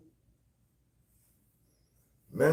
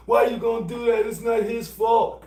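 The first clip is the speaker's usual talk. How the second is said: put-on higher, louder whiny girlfriend voice; mock girlfriend; 9 semitones higher, 4 dB louder than usual. Girlfriend defending her new man, high and whiny. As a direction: pitch it up as the protesting girlfriend. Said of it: "voicing the girlfriend".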